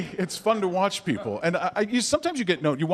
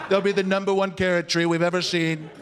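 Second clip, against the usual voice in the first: boastful politician impression; 3 semitones lower than usual. Candidate boasting about the carrot tree, self-assured impression. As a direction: declare it steadily as settled fact. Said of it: "he'll say".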